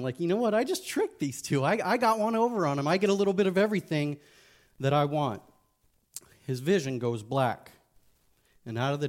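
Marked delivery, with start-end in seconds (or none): 0.2-5.4 high, fast, gloating scoff. Acted. Man on the fence gloats he tricked God and Satan, high scoffing. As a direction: crow like you just outsmarted both sides. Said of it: "he's kinda scoffing".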